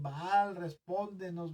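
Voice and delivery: loud, high, pleading call to Baal; a mock prophet of Baal crying out to his god. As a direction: cry out desperately like a pagan priest. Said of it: "y gritaban y se cortaban y decían".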